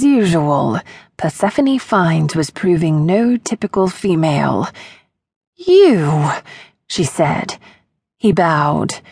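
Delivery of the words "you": startled. Acted. Startled woman recognizes him.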